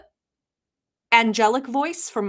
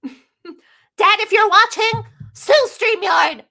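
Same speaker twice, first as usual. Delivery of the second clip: high, loud mock plea; calling out loudly; 11 semitones higher, 7 dB louder, much slower than usual. Streamer calling on Dad to sue, high comic shout. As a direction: holler it, stretching the last words.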